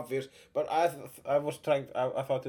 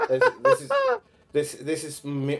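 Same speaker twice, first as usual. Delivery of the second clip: ordinary talk, nothing marked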